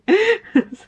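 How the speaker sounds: loud high laugh; high burst of laughter. Real, not acted. Vlogger laughing at saying bye so often, loud high laugh. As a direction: laugh out at yourself.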